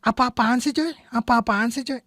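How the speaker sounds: annoyed casual retort. Shown as a demonstration. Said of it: "Di sini tepat".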